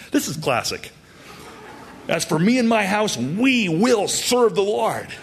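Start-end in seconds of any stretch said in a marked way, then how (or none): 2.1-5.1 high, ringing declaration of resolve; bold, high-pitched vow; certainty, expectancy. Acted. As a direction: proclaim it with total conviction, stretching the final words. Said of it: "he says with certainty, expectancy".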